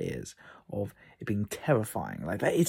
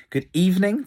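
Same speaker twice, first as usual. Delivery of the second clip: louder, higher and punchy; big loud greeting; 9 semitones higher, 10 dB louder than usual. Shown as a demonstration. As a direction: call it out to the whole audience. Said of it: "depending where you're listening from".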